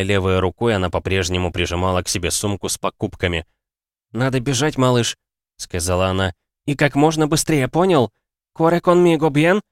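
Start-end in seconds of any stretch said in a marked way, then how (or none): 4.1-5.2 urging. A narrator's reading. Woman urging a boy to run. 6.7-9.6 high-pitched, pressing instruction; urgent urging. A narrator's reading. Woman urging a boy to run.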